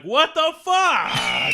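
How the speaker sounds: very high, loud, drawn-out shriek; mock panicked shout. Terrified hawk shrieking at the owl, drawn-out mock shriek. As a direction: scream it slow in mock terror.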